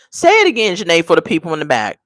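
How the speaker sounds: usual speaking voice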